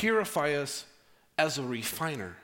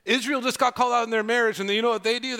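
louder, higher, fast preaching; building preaching; 5 semitones higher, 6 dB louder, faster than usual. Acted. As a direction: drive the point home with rising energy. Sermon building intensity.

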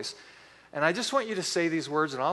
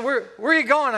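put-on loud, higher call after a kid at the door; yelling after him; 7 semitones higher, 6 dB louder than usual. Dad shouting after his son running outside, a loud call. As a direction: call out to stop him leaving. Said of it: "I yelled at him".

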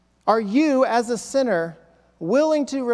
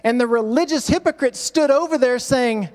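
ordinary talk, nothing marked

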